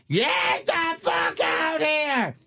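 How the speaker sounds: high, slow hammed-up yell; mock screaming mother. Mother screaming at the kids on Sunday, high mock yell. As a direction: bellow orders like a raging mom. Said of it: "she lost her fucking mind".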